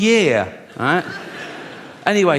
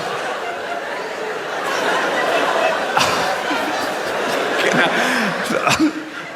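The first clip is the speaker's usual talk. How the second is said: high wheezing giggle; helpless airy laughter; high breathless laugh; 11 semitones higher than usual. Real, not acted. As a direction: laugh till the voice goes thin. Big laugh at himself.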